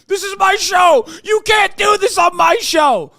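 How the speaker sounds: high, loud mocking tantrum; imitated host screaming about his show; mock raging shout. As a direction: yell like a host losing control. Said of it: "They're like".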